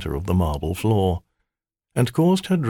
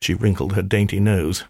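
ordinary delivery, nothing marked

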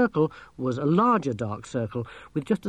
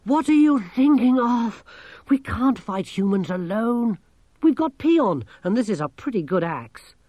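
urgent protest; 9 semitones higher, 5 dB louder than usual. A narrator's reading. Urging exhausted friend to fight.

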